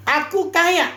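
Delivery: smug and pitched well up; boastful claim. Retold or imitated. Preacher mimicking the proud Laodicean church's smug boast. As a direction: brag like you own the world. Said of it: "Dia bilang".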